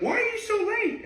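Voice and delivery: very high-pitched mock whine; mock exasperation. Manager asking the late singers, high-pitched mock exasperation. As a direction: squeal the complaint way up high.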